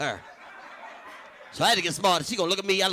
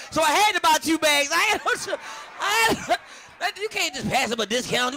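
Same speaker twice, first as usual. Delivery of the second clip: high, loud, laughing through it; can barely get the words out for laughing; 11 semitones higher, 4 dB louder than usual. Real, not acted. Comic cracking up retelling his weed bust, high and laughing.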